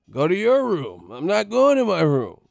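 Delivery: high, loud back-and-forth yelling; mock parent-kid shouting match. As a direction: yell both sides of the row. Parent and kid quarrel acted.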